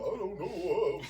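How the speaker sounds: a hint of mocking, clueless imitation; Elon Musk impression. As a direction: play baffled, softly sending him up. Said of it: "This is how he sound".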